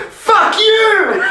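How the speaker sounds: high, loud yell at the door; angry yell. Retold or imitated. Man hit by the tram door, yelling at it. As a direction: explode at the door that tapped you. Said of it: "the door and just went".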